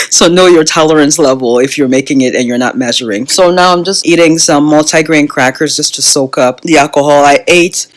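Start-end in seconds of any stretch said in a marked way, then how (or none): none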